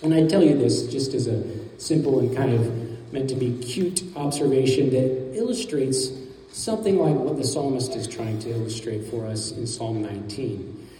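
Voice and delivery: usual speaking voice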